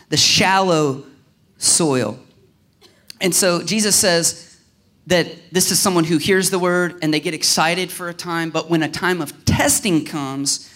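usual speaking voice

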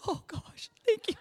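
tiny high squeal of gratitude; secretly thrilled. Retold or imitated. Dad inwardly thrilled by his daughter's compliment, squealing softly. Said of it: "but inside you're like".

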